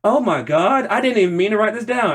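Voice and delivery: ordinary talk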